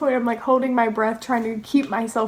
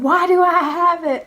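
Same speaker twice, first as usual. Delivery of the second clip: raised, louder sergeant imitation; retold stern questioning; 7 semitones higher, 5 dB louder than usual. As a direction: demand an explanation like a storyteller's sergeant.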